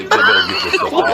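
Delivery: very high, fast squeak with a laugh; comic falsetto complaint; squeaky joke voice. Pretend. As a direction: pitch it up like a cartoon. Repeat in squeaky put-on voice.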